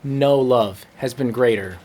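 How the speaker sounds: normal talking voice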